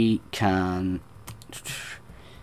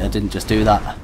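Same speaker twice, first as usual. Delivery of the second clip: usual speaking voice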